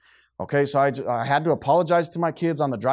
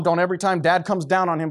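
normal talking voice

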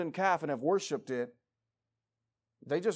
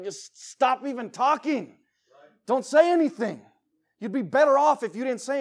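exasperated inner scream; 8 semitones higher, 8 dB louder than usual. Retold or imitated. Preacher venting at parents in McDonald's, exasperated outburst. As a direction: beg them to stop, fed up. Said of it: "I'm like".

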